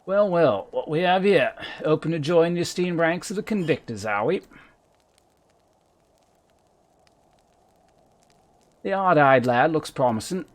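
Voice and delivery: a loud, high stab at a Cockney voice; half-hearted Cockney gruff camp leader; attempted Cockney. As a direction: try on a rough London accent and stumble. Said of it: "I can't do Cockney".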